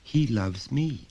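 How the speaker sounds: put-on a child answering simply; small boy. Son answering his father, lightly voiced child.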